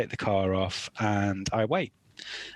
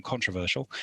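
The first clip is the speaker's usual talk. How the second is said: ordinary talk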